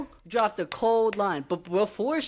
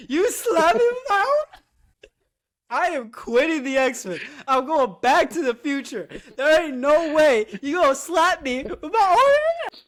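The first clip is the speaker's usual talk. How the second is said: mock outraged laughing; 6 semitones higher, 4 dB louder than usual. Speaking as slapped Cable.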